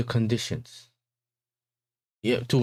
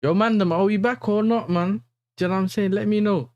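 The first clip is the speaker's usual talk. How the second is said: calling out to chat, raised and high; loud calling out; 8 semitones higher, 4 dB louder than usual. Real, not acted. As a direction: shout it to the chat, asking if they're back.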